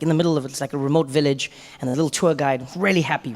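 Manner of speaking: usual speaking voice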